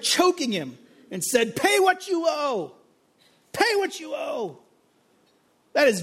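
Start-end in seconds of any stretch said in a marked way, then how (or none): 1.5-2.7 raised, slow demand, voice a touch higher; mock choking demand. The servant demands his debt while choking his fellow, a slow mock threat. 3.5-4.6 pitched well higher, slow, pressing the repeat; harder mock demand. The servant throttles his fellow and repeats the demand higher.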